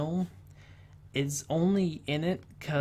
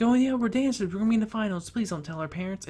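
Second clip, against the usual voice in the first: put-on high-pitched rush of giddy girls, easing down; mock excited girls; 7 semitones higher, 4 dB louder, faster than usual. Dancers pleading with the security guard, mock giddy excitement. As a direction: imitate excited kids, starting high and loud.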